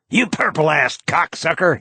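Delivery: understated read of a snarling scream; mild scream. A narrator's reading. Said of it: "he screams".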